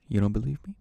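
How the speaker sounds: a sudden loud dare; loud challenge. Real, not acted. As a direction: confront the listener.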